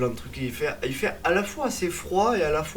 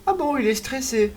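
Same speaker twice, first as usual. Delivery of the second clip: surprised nurse; 10 semitones higher, 4 dB louder than usual. Retold or imitated. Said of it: "l'infirmière qui me prend en charge me dit".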